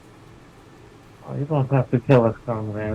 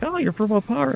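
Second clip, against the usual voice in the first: mock surprise; 10 semitones higher than usual. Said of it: "they're like".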